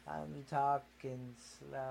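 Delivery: very quiet and slow; slow mock voice. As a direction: drag it out like her, softly. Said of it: "you go".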